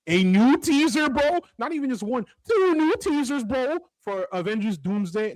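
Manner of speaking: high-pitched hyped announcement; excited. Real, not acted. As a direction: gush like a thrilled fan. Hyped movie news.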